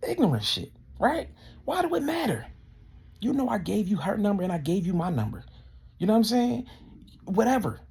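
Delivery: high, worked-up indignation; exasperated venting. Real, not acted. Venting about the collector who never called back, exasperated. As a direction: vent with the pitch shooting up.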